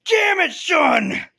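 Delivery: loud, high, drawn-out shout; hammed-up angry dad shouting; exasperated yell. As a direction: yell it after someone who's gone. Retold father yells after vanished son.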